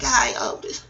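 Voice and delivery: whispered breathy hello to a statue; mock greeting.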